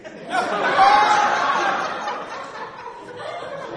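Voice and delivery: high loud burst of laughter; big laugh. Real, not acted. Preacher laughing at his own door-knocking story. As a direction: let the laugh explode.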